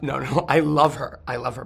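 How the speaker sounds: ordinary talk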